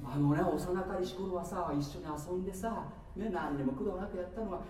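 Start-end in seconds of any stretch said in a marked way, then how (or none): none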